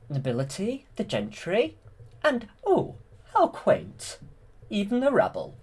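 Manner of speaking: high, swooping mock-regal villainess; Maleficent. Quoting Maleficent surveying the royal guests, hammed-up villainess. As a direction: list the guests with exaggerated, sweeping pitch. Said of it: "she says something like".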